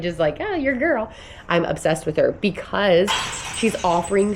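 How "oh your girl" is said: higher, playful teasing imitation of Tyler; teasing husband. Husband teasing her about her online girl crush, playful mock voice. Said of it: "he's like".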